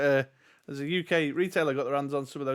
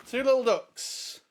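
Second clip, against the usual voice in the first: bingo caller; 9 semitones higher, slower than usual. Pretend. Bingo call for twenty-two.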